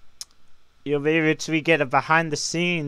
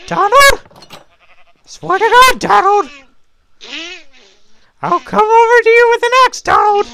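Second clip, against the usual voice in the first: much higher, much louder yelling; angry shout; 11 dB louder than usual. Real, not acted. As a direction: scream at him to stop. Yelling at friend in duck voice.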